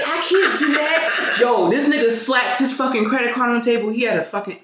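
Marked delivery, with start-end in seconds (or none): 0.1-1.4 high, slow, laughing refusal; laughing protest. Retold or imitated. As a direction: protest through giggles.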